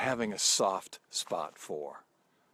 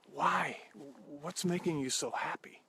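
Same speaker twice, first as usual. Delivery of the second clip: put-on higher, eager young man's voice; eager nephew; 6 semitones higher, 3 dB quieter than usual. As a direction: lift the pitch like an amazed nephew. Ed asks his uncle why.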